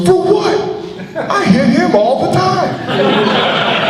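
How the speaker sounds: high, loud, laughing protest; hammed-up, high-pitched protest to the umpire, slowing down; indignant pitcher. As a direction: play the pitcher's outraged innocence for laughs.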